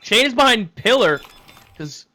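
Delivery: loud, high esports call; live play-by-play. Real, not acted. Caster calls the defuse attempt to viewers, excited play-by-play.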